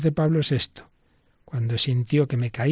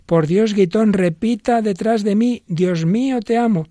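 much higher and louder, pleading hard; urgent insistence; 9 semitones higher, 6 dB louder than usual. Acted. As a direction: demand he repeat it, all but shouting. El Papa insiste, exasperado. (súplica insistente, mucho más aguda y fuerte)

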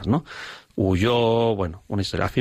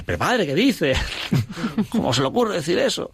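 lightly voiced teenage girl, higher, with a laugh; teen girl, mock-shocked; 8 semitones higher than usual. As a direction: play the scandalised girl half-teasing, pitched up. Girl's shocked reply, retold by priest. (chica escandalizada, voz más aguda y medio riendo)

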